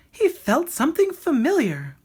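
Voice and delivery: high little bird voice reading a story; bird reading aloud. A narrator's reading. The bird reading the dog story to Rocket, high and lively. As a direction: read it like a lively songbird storyteller. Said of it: "the bird read".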